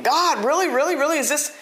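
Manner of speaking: high-pitched doubting plea; incredulous questioning. Acted. Doubter questioning God whether this is really so, incredulous.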